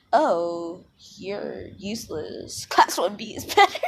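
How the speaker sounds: drawn-out mock taunt, breaking into laughter; slow, hammed-up put-down that ends in a laugh; taunting Monoma. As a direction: play a gloating rival, then crack up. Teller mimics taunting Monoma, then apologises.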